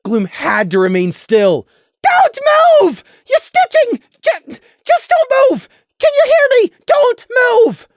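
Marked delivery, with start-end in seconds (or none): 2.0-7.9 very high, loud frantic yelling; very high, panicked shouting; desperate shout. Acted. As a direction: yell to keep a friend still. Prey yelling at wounded Gloom.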